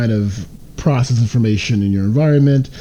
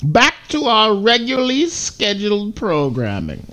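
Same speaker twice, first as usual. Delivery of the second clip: announcer voice; 11 semitones higher than usual. Pretend. Mock announcer sign-off.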